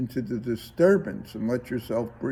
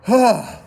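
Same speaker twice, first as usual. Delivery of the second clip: yawn sigh; 8 semitones higher, 8 dB louder than usual. Shown as a demonstration. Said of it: "Nothing like a good yawn".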